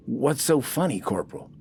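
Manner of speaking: high, baffled question; puzzled. Acted. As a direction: ask it thrown, confused.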